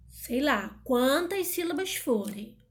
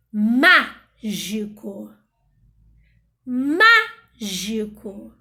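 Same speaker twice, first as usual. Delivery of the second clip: mágico with MÁ loud and long, then a gap; stress on first syllable; 2 semitones lower, 9 dB louder, much slower than usual. Shown as a demonstration. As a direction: punch and stretch the MÁ of mágico. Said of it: "se for a antepenúltima". (mágico com o MÁ forte e demorado)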